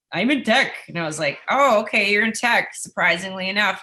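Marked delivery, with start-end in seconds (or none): 0.1-0.7 guy's voice. Retold or imitated. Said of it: "the guy was like". 1.5-2.7 high, loud mock surprise; joking. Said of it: "I was joking".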